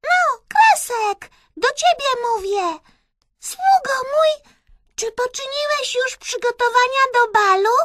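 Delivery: bossy, very high mouse princess; Myszka squeaking. A narrator's reading. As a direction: squeak orders at a servant. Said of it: "zapiszczała Myszka".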